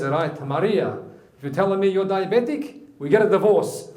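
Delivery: hammed-up outrage, building to the last word; indignant husband. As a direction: lay down the law as a fed-up comic husband. Acted husband threatens divorce.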